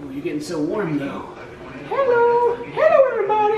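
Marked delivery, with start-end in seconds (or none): 1.9-3.6 very high, cooing hello; high pet voice. Real, not acted. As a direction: greet them like tiny babies. Greeting pets in high voice.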